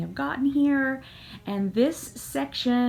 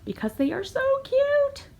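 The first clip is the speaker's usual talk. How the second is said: excited squeal. Real, not acted. Gushing over cute fabric.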